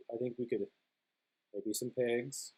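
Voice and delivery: ordinary delivery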